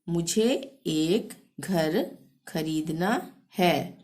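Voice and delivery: मुझे एक घर खरीदना है dragged out, word by word; slow repeat. Shown as a demonstration. Teacher repeating the Hindi sentence for students, slowly. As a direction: stretch the sentence slowly for learners. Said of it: "slow repeat for the learners".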